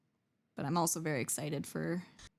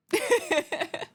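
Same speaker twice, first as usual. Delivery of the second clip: high giggle; 12 semitones higher, 4 dB louder than usual. Real, not acted. Laughs at the reveal.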